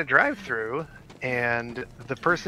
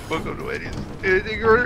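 mock crying voice; 8 semitones higher, faster than usual. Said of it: "a crying voice".

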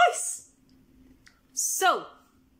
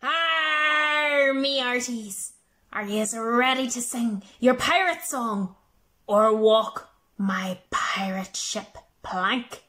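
pirate voice; 9 semitones lower, 3 dB quieter than usual. Acted. I loved your big pirate voices.

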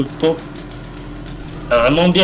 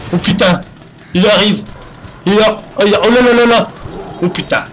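panicked; 6 semitones higher than usual. Real, not acted. Chainsaw killer approaching, panicking.

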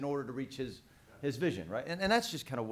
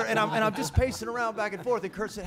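ordinary delivery

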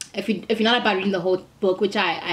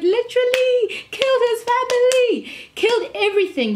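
much higher, louder cry; dramatic high outburst; 4 dB louder than usual. Real, not acted. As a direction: pour out the horror of it, loudly. Dramatic retelling of devil's attack.

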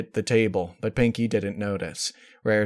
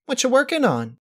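bubbly pony voice; 4 dB louder than usual. A narrator's reading. Pinkie voiced by narrator.

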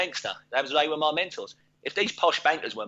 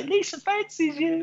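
slow, much higher teasing voice; teasing singsong. Retold or imitated. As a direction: drag it out high to wind her up. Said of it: "I used to say".

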